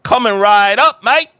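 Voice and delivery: loud, high Cockney robot bartender; booming mock-Cockney barman greeting a customer; bad Cockney accent. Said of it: "loud as shit in his bad Cockney accent".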